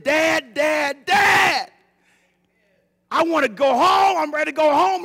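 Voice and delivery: high, loud shout to a father; crying out. Retold or imitated. Son in hard times begging to go home, crying out loudly. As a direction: call out desperately to God.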